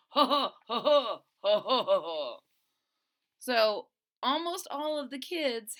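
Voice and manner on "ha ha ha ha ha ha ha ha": loud mocking villain cackle; nasty laugh. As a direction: laugh like a mean bully walking away. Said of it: "walked off with a nasty laugh".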